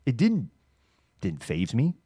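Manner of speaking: ordinary talk, nothing marked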